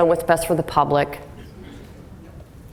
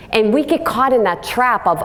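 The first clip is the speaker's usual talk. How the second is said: normal talking voice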